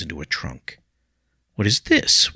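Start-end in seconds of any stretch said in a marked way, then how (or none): 1.5-2.3 sharp, raised demand, louder and higher; a young man confronting his teacher. A narrator's reading. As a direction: bark the question at him. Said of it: "William demanded".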